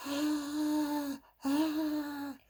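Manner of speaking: high-pitched mock scream, held out; children screaming. A narrator's reading. Storyteller acts out terrified kids at the vent, screaming. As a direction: scream like a frightened kid in a story. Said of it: "the kid started screaming".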